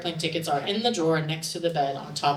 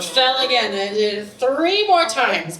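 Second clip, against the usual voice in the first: normal talking voice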